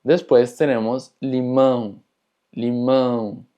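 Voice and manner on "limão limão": limão with a nasal "ão" ending; sonido nasal. Shown as a demonstration. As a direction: send the ão of limão through the nose. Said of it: "indica un sonido nasal". (limão con «ão» nasal)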